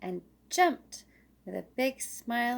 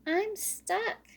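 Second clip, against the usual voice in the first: much higher, small worried voice; high squeaky little squirrel; 11 semitones higher than usual. Acted. Stuck Little Squirrel tells Daddy, high and worried.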